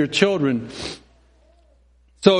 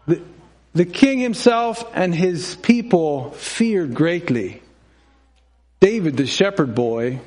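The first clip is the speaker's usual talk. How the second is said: usual speaking voice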